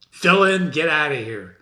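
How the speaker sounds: normal talking voice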